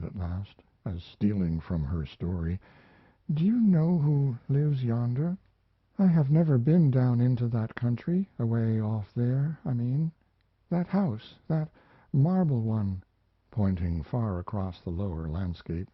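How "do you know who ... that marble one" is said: put-on higher, wistful lonely girl; a lonely young woman remembering, a little lifted; higher, wistful voice of a lonely mountain girl; young woman's voice. Mariana speaks to the narrator.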